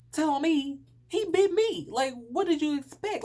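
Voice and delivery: high, loud, outraged retort; indignant yell. Retold or imitated. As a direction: shout back in disbelief. Retold outraged reply to classmate.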